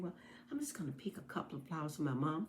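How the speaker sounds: fast, soft whisper to herself; a little girl whispering to herself. Acted. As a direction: whisper it quickly, like a secret plan.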